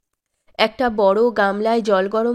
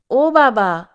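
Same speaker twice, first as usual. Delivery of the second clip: louder call across the house; 5 dB louder, much slower than usual. A narrator's reading. Son calling loudly to his old father. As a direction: shout to the old man in the next room. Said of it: "চেঁচিয়ে ডেকে বললেন".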